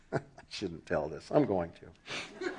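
low, soft laughing confession; real giggle under the words; suppressed laughter. Real, not acted. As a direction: chuckle quietly through the admission. Amused, confesses before risky story.

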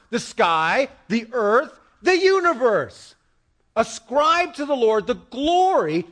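emphatic, drawn-out preaching cadence. Real, not acted. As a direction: proclaim it to the whole congregation.

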